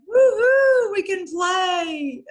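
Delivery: high, loud drawn-out whoop of joy; over-the-top "we're free to play" cheer; excited cheer. Acted. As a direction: squeal it out like celebrating kids. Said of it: "we're all excited".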